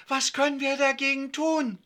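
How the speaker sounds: high, loud staged worry; mock anxious. Yvonne rehearses for the parents, play-acted anxious plea. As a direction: act frightened for a rehearsed question. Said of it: "Und ich frage ängstlich".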